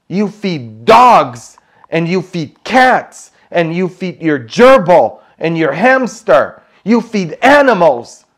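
loud, high, hammering each animal; indignant retold rebuke. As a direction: scold emphatically, punching each animal name.

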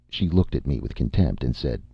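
ordinary delivery, nothing marked

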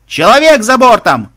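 high, loud shout of alarm; urgent man-overboard yell; alarm call from above. Acted. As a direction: cry it out across the ship. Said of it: "донеслось сверху".

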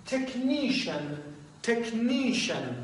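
teacher models تکنیشن for students, slow and careful; model pronunciation. Shown as a demonstration. Teacher demonstrating تکنیشن slowly. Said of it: "کلمهٔ بعد".

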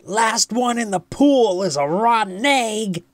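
put-on high, loud playful kid's challenge; high-pitched gleeful shout; excited yell. A narrator's reading. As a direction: yell it out like a boy starting a race. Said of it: "yelled Buzz".

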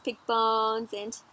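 ordinary delivery

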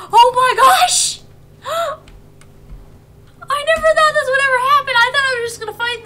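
high, loud thrilled exclaim; overjoyed disbelief. Real, not acted. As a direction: shout it in real delight. Finally catches the Eevee.

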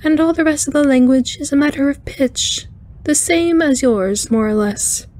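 high, chiming alien voice; cheerful arachnoid engineer. A narrator's reading. Alien reassuring the captain about her name, high chiming voice. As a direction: chime it out high and easygoing.

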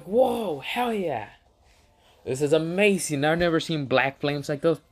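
high-pitched, acted excitement; thrilled amazement. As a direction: start way up high in awe, ease down slightly. Acts out the thrilled film crew.

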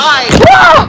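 sudden high yelp of fright; startled scream. Real, not acted. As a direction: jump and yelp, caught off guard. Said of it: "That scared me".

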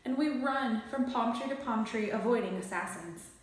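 usual speaking voice

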